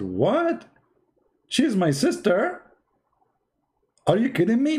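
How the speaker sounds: high, slow, disbelieving gasp of a question; he can't believe his ears; shocked son. Retold or imitated.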